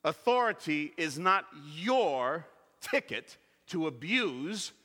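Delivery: ordinary talk, nothing marked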